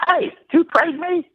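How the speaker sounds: very high-pitched mock player's voice; high, comic impression of a ballplayer; Larry Bird impression. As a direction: pitch it way up, calling out a startled question. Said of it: "try to do my Larry Bird impression".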